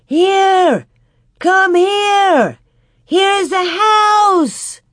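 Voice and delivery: high, loud, drawn-out call through a blizzard; full-force yell to be found; yelling with all might. A narrator's reading. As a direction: shout it long and urgent across a storm. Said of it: "With all her might, she yelled".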